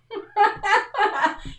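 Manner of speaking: bright high burst of laughter; a short high laugh. Real, not acted. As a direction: laugh at your own joke. Laughs at own joke.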